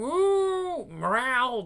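high cat yowl; cat meow. Acted. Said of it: "That's a cat".

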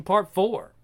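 ordinary delivery

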